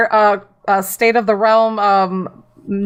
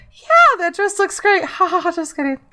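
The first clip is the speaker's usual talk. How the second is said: high, exaggerated mock praise; hammed-up fake compliment; 6 semitones higher than usual. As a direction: lay the flattery on thick, then fake-laugh it off. Acted fake compliment, joke.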